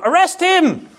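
high-pitched mock cry of accusation; mock shout. Preacher acts the shout the girl never made, mock shout. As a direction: call it out high, half shouting. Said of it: "She didn't shout".

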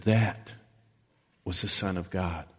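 low, hushed quote with a pause after "That"; pointed declaration. Retold or imitated. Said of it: "Mark's account is a little more pointed".

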